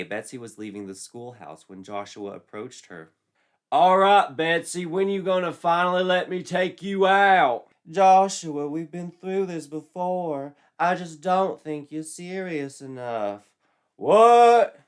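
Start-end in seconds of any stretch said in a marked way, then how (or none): 3.7-7.7 loud, high, pushy jock voice; brash jock. Acted. Joshua pressing Betsy for a date, loud and brash. 7.9-13.5 put-on high, slow girlish voice; girlish Betsy. Betsy turning Joshua down, high and slow. 14.0-14.8 loud high yelp of disbelief; shocked jock. Acted. Joshua stunned by the rejection, loud high yelp.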